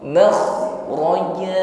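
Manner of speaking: slow "Makhraja" with heavy kh; "Makhraja" with a rasped, heavy kh; thick kha. Shown as a demonstration. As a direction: chant "Makhraja", drawing out the final a. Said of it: "tebalkan pengucapan kha".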